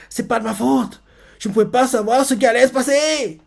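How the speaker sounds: voix aiguë et geignarde qui se défend; Deku paniqué imité. Retold or imitated. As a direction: singer un gamin qui se dédouane, très aigu. Héritier imite Deku paniqué. (voix aiguë de Deku qui se justifie)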